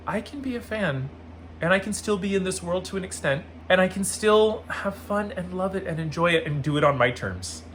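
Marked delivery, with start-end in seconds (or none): none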